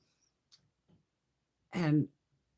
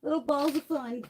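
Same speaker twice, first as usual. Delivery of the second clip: much higher, playful little voice; pitched-up cutesy sing-song; 11 semitones higher than usual. Pretend. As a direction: say it like something adorable. Cutesy comment on eyeshadow balls.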